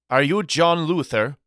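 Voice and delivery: put-on high-pitched inquiry in a thick European accent. A European deliveryman asks Daddy in thick European accent. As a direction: greet him with a heavy European accent. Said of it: "he inquired with a thick European accent".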